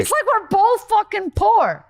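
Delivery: loud, high, jeering; mock indignant. Kid sneering at a neighbor on food stamps, loud and high. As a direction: jab back at a fellow poor kid. Retold kids' jab, much louder.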